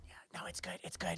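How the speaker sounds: very soft, near-whispered; hushed reassurance. Acted. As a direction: murmur it to smooth over the awkwardness. Hushed reassurance after awkward apology.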